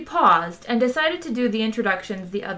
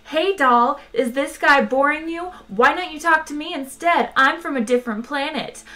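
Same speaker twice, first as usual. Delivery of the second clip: higher, cocky chat-up voice; brash pick-up artist; 7 semitones higher than usual. A narrator's reading. Arthur mimics Zaphod stealing the girl, brash chat-up. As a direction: barge in and chat her up. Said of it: "barges up and says".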